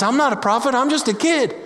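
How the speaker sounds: put-on high, childish protest; whiny kid Jeremiah. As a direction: push back like a small boy. Jeremiah objects to God.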